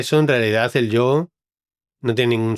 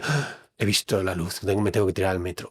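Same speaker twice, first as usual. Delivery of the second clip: hushed revelation; 4 semitones lower, 6 dB quieter than usual. Acted. Acted enlightened person.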